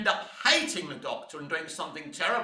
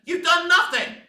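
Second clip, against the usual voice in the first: higher, louder accusing yell; angry shout; 9 semitones higher, 6 dB louder than usual. Real, not acted. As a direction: yell it at the producers. Ranting at producers.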